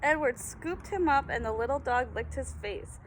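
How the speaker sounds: ordinary delivery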